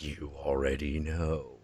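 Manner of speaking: low, unhurried demonic voice; demon's voice. Retold or imitated. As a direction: answer slowly and low, as the knowing demon. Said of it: "it's basically like".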